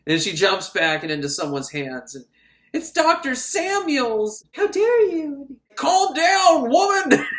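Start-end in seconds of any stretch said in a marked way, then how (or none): 2.7-5.6 high, slow, drawn-out dramatic cry; mock melodrama. Recapper reveals the doctor grabbing her, high slow mock melodrama. 5.7-7.3 loud, high-pitched hammed-up order; mock scolding shout. Doctor shaking a panicking woman, loud high mock scolding.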